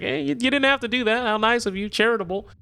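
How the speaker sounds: high, sarcastic sing-song thanks; high mocking thank-you; mock gratitude. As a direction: thank them with dripping sarcasm.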